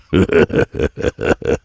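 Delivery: breathy, rolling chuckle. Acted. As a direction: laugh in short breathy bursts. Smith laughs after admitting thin skin.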